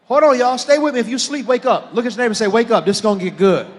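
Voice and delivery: loud, high call to wake the room; rally the sleepy congregation; rousing preacher call. Real, not acted. Preaching at full force.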